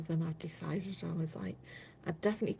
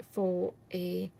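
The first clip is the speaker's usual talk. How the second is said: normal talking voice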